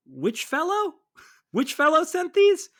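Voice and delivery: put-on high, loud, laughing old-lady voice; confused old woman. The widow asks Tori who sent the flowers, in a high, laughing voice. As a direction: play her baffled and high-pitched. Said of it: "she's just like".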